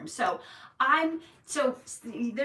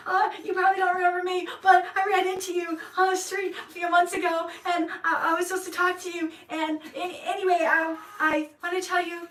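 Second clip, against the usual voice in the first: high, fast, flustered babble; nervous rambling; 6 semitones higher, 3 dB louder than usual. Retold or imitated. Nervous narrator explaining herself to the woman, high flustered babble. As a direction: stumble over words anxiously.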